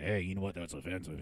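low, soft mock of offended person. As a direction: lightly voice a touchy critic.

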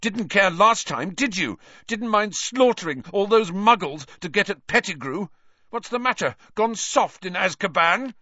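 high, heated accusation; angry spitting. A narrator's reading. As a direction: hurl scornful questions at your enemy. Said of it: "Harry spat".

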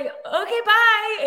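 high, squeaky flustered goodbye; awkward quick goodbye. Retold or imitated. A flustered fan leaves Keanu, squeaking a high goodbye. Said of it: "and then I was like".

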